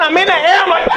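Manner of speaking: high laughing shriek of panic; mock scream. Comic telling his skydiving panic, high laughing shriek. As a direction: shriek it out in fake terror.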